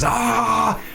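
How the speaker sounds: high, drawn-out scream of agony; acted scream of a maimed farmer; pained scream. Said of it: "falls to his knees screaming".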